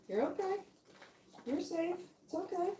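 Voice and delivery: high, soft reassuring coo; calm the puppy down gently. Real, not acted. Calming a scared puppy in soft high baby talk.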